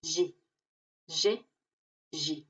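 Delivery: G said soft like zh; Portuguese soft G. Shown as a demonstration. Teacher drills the soft Portuguese G. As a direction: voice G as a soft zh sound. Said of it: "se pronuncia G".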